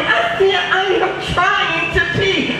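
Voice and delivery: high, slow, drawn-out protest; teacher caught at the urinal; exasperated. Retold or imitated. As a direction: plead in mock exasperation.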